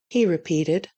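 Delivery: ordinary delivery, nothing marked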